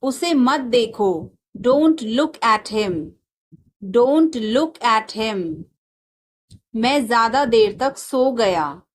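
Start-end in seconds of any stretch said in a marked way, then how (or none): 3.8-5.6 slow, careful classroom repeat. Shown as a demonstration. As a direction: spell it out slowly for the class. Teacher repeats slowly for learners. (धीरे-धीरे दोहराते हुए)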